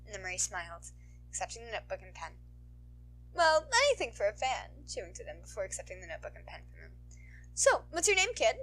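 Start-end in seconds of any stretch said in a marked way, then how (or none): 3.3-4.9 higher, louder, sweet-toned heroine voice; sultry heroine. 7.6-8.6 higher, louder, sweet-toned heroine voice; sultry heroine.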